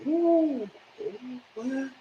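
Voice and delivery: very high, soft gasping ooh of shock; crowd gasping. Retold or imitated. Courtroom crowd gasping in high shocked surprise. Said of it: "everybody was like".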